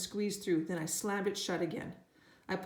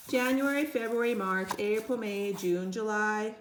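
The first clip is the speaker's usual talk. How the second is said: recited; 2 semitones higher, 4 dB louder than usual. A narrator's reading. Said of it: "Fudge recited".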